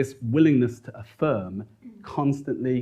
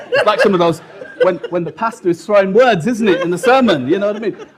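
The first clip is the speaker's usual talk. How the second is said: animated preaching mimicry; 8 semitones higher, 7 dB louder than usual. Mimicking preacher throwing words.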